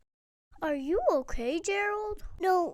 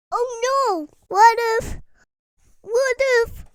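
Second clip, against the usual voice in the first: panicked; 4 semitones higher, 8 dB louder than usual. Acted. Panicked realisation about pigs.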